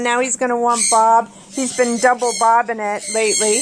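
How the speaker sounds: usual speaking voice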